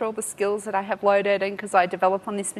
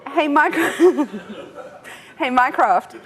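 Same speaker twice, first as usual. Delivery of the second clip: breaking into laughter, higher and louder; laughing; 4 semitones higher, 4 dB louder than usual. Real, not acted. Presenter retrying the wake word to the assistant, laughing. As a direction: crack up mid-phrase, then try to get it out.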